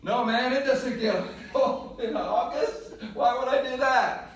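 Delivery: put-on loud, high protest in a Venezuelan accent. Friend protesting about warm clothes in August, Venezuelan accent. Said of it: "In his Venezuelan accent".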